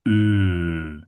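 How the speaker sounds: low, held Ö with rounded lips; isolated ö vowel. Shown as a demonstration. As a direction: sustain a German Ö on its own. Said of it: "that is the first vowel that sounds very different".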